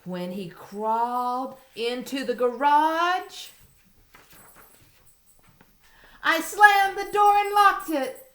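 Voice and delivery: high, loud, building excitement; excited. Acted. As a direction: build up to a big triumph. Story climax, door slammed.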